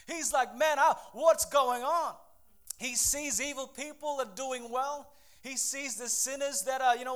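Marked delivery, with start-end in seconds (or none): 0.5-2.2 loud, slow exasperated outburst; vexed. Acted. Preacher voicing the angry psalmist, loud and vexed. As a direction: act ticked off, stretching it out loudly. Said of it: "He is angry. He is vexed. He is ticked".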